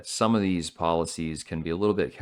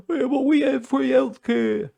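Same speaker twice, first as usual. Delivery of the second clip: high, loud mocking squeak; mocking Brit voice; 7 dB louder than usual. Commentator mocking British retort, high squeaky mock voice. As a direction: squeak it out like a smug Brit taunting Americans.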